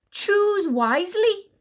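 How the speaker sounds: high, loud, slow warning; crying out. A narrator's reading. Astonished queen warning the enchanter, crying out. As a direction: cry it out in exasperated fury. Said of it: "she cried".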